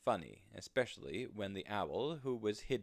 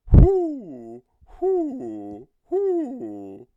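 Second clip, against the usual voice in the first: very high, much louder, contented hoots; happy owl hoots; 20 dB louder, much slower than usual. Acted. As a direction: hoot like a pleased owl on a branch. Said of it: "in a happy voice".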